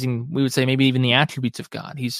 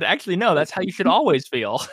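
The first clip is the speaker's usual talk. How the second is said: chuckling reply; 5 semitones higher than usual. Retold or imitated. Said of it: "He kinda chuckled".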